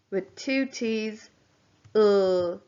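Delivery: normal talking voice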